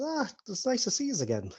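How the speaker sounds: high, soft character voice; friendly sister greeting. Acted. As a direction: greet old acquaintances in a light, high voice. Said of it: "Robin and Lily come over".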